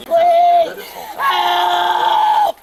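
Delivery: loud panicked cries for help; shouting for help. Real, not acted. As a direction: scream out for help. Woman yelling for help at arrest.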